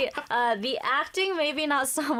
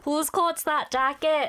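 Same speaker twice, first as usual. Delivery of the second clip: slow, higher Welsh accent; 4 semitones higher, slower than usual. Shown as a demonstration. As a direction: stretch it out in a singsong Welsh accent. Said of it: "you can do the Welsh accent".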